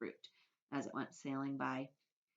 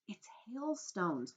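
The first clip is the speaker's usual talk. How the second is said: excited whisper; 4 semitones higher, much slower than usual. Acted. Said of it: "whispered James excitedly".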